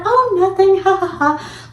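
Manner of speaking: very high, fake-laughing brush-off; imitating weird cheerfulness.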